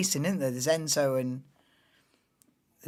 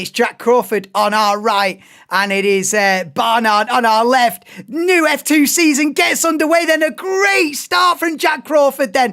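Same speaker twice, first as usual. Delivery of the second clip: louder, higher play-by-play call of the start; race commentary; 9 semitones higher, 9 dB louder than usual. Acted. Streamer calling the F2 grid for viewers, excited commentary.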